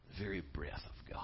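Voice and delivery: hushed whisper of wonder; awed whisper. Acted. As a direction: breathe it like a secret. Awed hush over God's breath.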